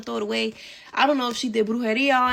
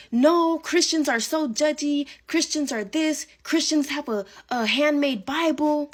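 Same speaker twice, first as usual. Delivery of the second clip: higher, slow, mock-scornful bestie; dismissive bestie; 4 semitones higher than usual. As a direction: play the friend waving Christians off.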